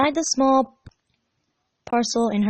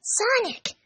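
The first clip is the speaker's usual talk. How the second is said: excited whisper. Acted. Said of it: "he whispered".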